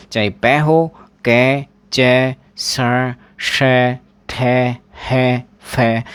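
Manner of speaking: each sound said slowly on its own; sounds listed. Shown as a demonstration. Teacher rehearsing the consonant list again. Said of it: "इन जो नौ में से".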